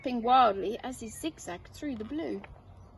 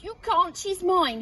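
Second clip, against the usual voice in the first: higher, louder possessive roar; mock dragon roar; 8 semitones higher, 6 dB louder than usual. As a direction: stake a claim fiercely. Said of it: "he roared".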